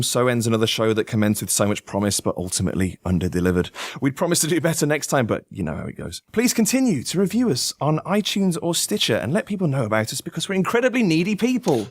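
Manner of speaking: mock-grand show sign-off; lightly announcer-style wrap-up; announcer outro.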